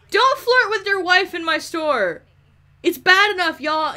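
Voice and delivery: loud, high telling-off; exasperated shopkeeper yell; indignant scolding. Pretend. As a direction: shout at misbehaving customers. Scolding customers in her store.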